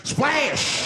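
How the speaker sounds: high spoken splash effect; water hitting his face; splash sound. Retold or imitated. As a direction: shout the splash noise.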